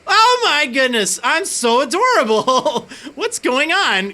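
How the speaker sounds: thrilled disbelief, laughing; delighted shock. Acted. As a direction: squeal at your own cute reflection. Sees own reflection as Pokemon.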